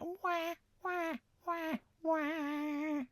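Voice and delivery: high, soft "wah" repeated; mock plaintive wailing; sad cupboard wail. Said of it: "open the cupboard, it was like".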